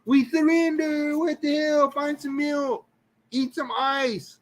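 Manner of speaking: tiny, very high cartoon hollering; screaming taste buds. Burnt taste buds begging for relief, comic high-pitched screaming. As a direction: squeal it like little panicked creatures, drawn out. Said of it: "little taste buds screaming and hollering".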